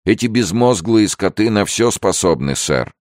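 high-pitched, worried warning; agitated warning. A narrator's reading. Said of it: "предупредил его негр". (взволнованное предостережение)

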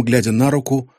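ordinary delivery, nothing marked

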